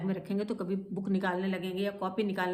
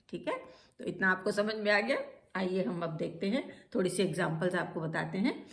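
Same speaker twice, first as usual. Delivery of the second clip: normal talking voice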